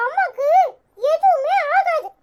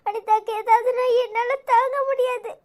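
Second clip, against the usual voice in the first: ordinary delivery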